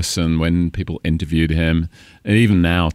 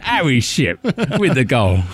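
slow, higher Cockney call of Harry Shipp with the goal; Cockney stadium goal announcement; 7 semitones higher than usual. Pretend. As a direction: announce the goal in an East End London accent. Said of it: "sounds so East End London".

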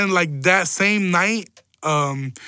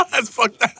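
high squealing laugh breaking into words; real laugh, pitched way up; odd high laugh; 5 semitones higher than usual. Real, not acted. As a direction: cackle through the words, caught off guard. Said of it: "I don't know why I laughed like that".

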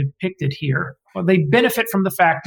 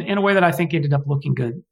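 usual speaking voice

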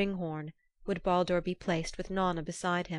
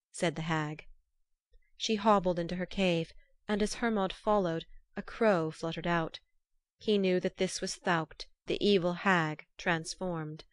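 plain reading voice

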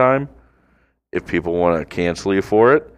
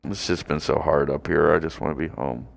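softer, mopey mock lament; mock homesick; 5 semitones lower, 8 dB quieter than usual. Host pining for his cat, mock sad and soft. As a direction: whine it like a homesick kid.

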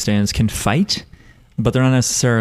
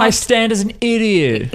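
exasperated; 11 semitones higher than usual. Retold or imitated. Mocking Abacchio line.

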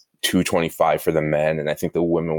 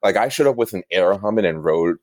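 ordinary delivery

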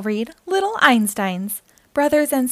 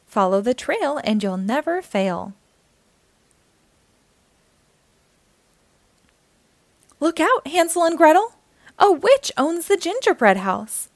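bright, lively read-aloud voice; reading to children. A narrator's reading. Storyteller reading a picture book aloud to young listeners, lively and bright. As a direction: read it like storytime for little kids.

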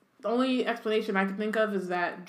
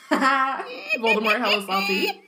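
higher-pitched, talking through laughter; laughing; 5 semitones higher, 4 dB louder than usual. Real, not acted. Co-host amused at salty Voldemort, laughing. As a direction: crack up while saying it. Cracking up at co-host's joke.

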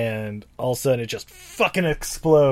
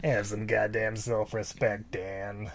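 creepy creature voice; 3 semitones lower, 9 dB quieter, slower than usual. Retold or imitated. Voicing the gin.

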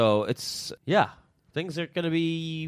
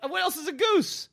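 baffled high question. Real, not acted. Baffled reply in goose debate.

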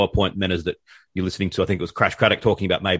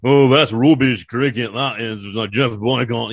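slow, loud mock Yorkshire grumble; broad Yorkshire accent; 3 semitones higher, 4 dB louder, slower than usual. Co-host mocks the guest's supposed Yorkshire accent, slow and loud. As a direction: growl it like a grumpy old Yorkshire pundit. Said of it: "That's not".

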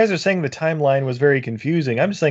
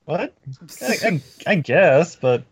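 put-on Peter Jackson New Zealand accent; slower than usual. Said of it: "My New Zealand accent is on point".

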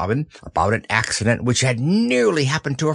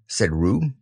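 ordinary delivery, nothing marked

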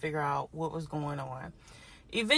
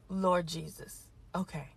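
exasperated sigh; 5 dB quieter than usual. Real, not acted. Fed up with the agent on the phone, heavy soft sigh. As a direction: let the air out before Lord Jesus.

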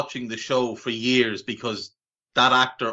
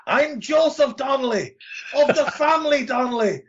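high-pitched, hammed-up bad Irish accent; horrendous Irish accent; 13 semitones higher than usual. Co-host mocking Tom Cruise's film role, horrendous Irish accent. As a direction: overdo a terrible Irish brogue, pitched way up. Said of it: "Oh, it's horrendous".

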